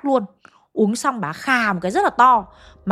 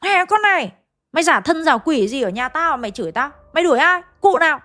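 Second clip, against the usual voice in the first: raised pitch, indignant retold tirade; angry cursing back; 5 semitones higher than usual. Furious eldest son curses back at the medium, angrily. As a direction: challenge the supposed spirit as a fake, fuming. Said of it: "ông chửi lại".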